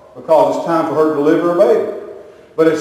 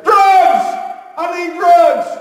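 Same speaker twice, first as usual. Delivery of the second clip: imitated desperate yell; 12 semitones higher, much slower than usual. Wife in natural childbirth demanding relief, high mock scream. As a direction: cry out for relief in hammed-up agony.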